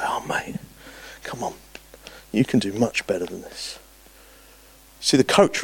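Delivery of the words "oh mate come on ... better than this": soft, hushed coaxing; gentle encouragement. Retold or imitated. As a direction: coax him kindly, almost whispering.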